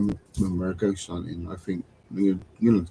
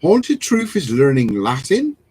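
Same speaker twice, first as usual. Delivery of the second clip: high, loud, with a steep rise at the end; surprised question; 4 semitones higher, 6 dB louder than usual. Real, not acted. Host repeating a viewer's news about learning Latin, surprised. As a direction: echo it in disbelief, swooping up on Latin.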